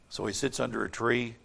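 usual speaking voice

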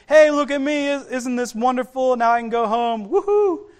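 high, loud mock celebration with a whoop; excited bragging. Healed man just saying look at me, excited. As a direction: crow about it like an elated show-off. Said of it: "He's so excited about it".

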